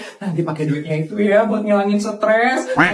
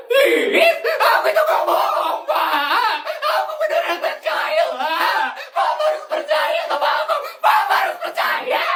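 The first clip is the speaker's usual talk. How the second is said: wailing, much higher, crying out; sobbing plea. Acted. Feli begs her father to believe her, wailing sobs. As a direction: howl it through tears, desperate.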